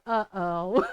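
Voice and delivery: low, drawn-out uh-oh; knowing dread. Retold or imitated. Mom who knew where it was going, ominous. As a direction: sink low, seeing trouble coming. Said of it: "Because I knew where it was going".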